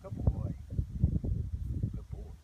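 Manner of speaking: whispered, soothing praise; calming whisper. Shown as a demonstration. Trainer calming his horse, whispered praise. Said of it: "use it to help calm the horse".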